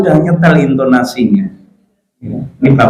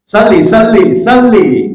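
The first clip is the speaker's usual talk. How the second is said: calling to wake; 5 semitones higher, slower than usual. Acted. Acts out waking his son.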